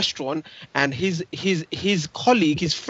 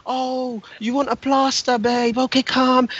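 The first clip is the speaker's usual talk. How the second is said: higher, soothing nurse impression; mock kindly nurse; 6 semitones higher, slower than usual. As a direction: play the nurse humouring him. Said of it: "And the lady's gonna".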